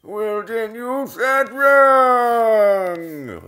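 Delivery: loud, high, drawn-out wail; mock scolding shout. Host scolding himself over a failed save, mock outraged wail. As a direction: shout it high and stretch the last word.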